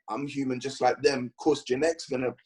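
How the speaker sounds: ordinary talk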